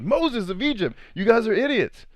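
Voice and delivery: high, fed-up skit Jesus; exasperated Jesus. Acted. Jesus scolding his followers in a high exasperated voice. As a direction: scold them like a weary prophet. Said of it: "that's what Jesus said".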